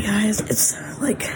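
hushed whisper so nobody wakes. Real, not acted. Mom at night with kids asleep, hushed whisper. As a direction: keep it to a breath-only whisper.

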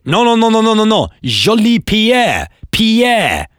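high, exasperated French officer correcting; French language police. Acted. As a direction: correct the parrot in hammed-up French, high-pitched. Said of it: "imagine the language police turning up".

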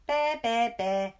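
loud, high bratty bleating; sing-song mocking, loud and high; childish taunting. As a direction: taunt grandma like a cheeky kid. Boy taunts his grandma.

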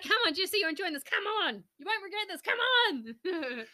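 high, loud cheery coaxing; pep-talk a tired friend; loud chirpy cheerleading; upbeat coaxing. Retold or imitated. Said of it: "I was like".

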